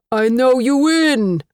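put-on loud Armenian-accented landlord through a door; booming landlord. A narrator's reading. Landlord pounding on tenant's door, booming voice. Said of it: "his voice boomed through the door".

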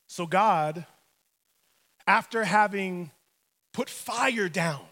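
normal talking voice

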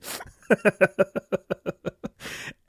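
airy wheezing laughter; can't stop laughing, all breath; breathy laugh. Real, not acted. Still wheezing with laughter over the brother's prank.

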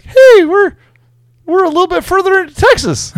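mock weary cheer. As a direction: overplay a cheerful traveller who has been driving forever. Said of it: "you're like".

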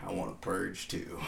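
soft, put-on movie teaser voice; teaser trailer voice. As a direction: imitate the teaser's purge line, keeping it quiet. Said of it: "teaser trailer voice".

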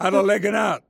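high, run-together Yorkshire dialect call. Shown as a demonstration. Boy at a friend's door asking him out, Yorkshire dialect. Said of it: "I spoke dialect".

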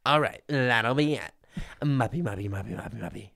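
mock-feminine delivery dissolving into mumbled babble; feminine college self. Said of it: "I talked very feminine".

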